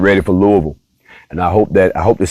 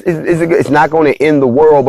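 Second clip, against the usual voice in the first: normal talking voice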